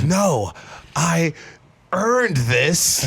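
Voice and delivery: high, drawn-out defiant cry; hammed-up desperate refusal, word by word; struggling protest. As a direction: act out fighting off someone taking your prize. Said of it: "him struggling with the".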